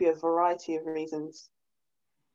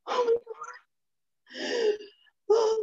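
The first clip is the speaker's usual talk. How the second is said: high breathy gasp of a swooning woman, hammed-up; mock swoon; 13 semitones higher, slower than usual. Mocking a woman won over by a baby line, high and swooning. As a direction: gush it like she's falling for him.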